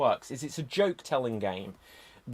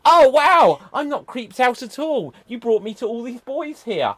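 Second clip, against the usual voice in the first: loud, high put-on reaction; hammed-up girl character; 8 semitones higher, 10 dB louder than usual. Wendy meets all the boys, hammed-up girl character. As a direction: exclaim big, then carry on pitched high.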